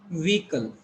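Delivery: vehicle with long E and silent H, VEE-kul; teacher modelling vehicle with a long "ee"; correct pronunciation. Shown as a demonstration. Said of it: "long E".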